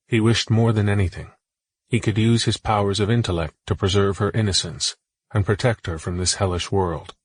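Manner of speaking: ordinary delivery, nothing marked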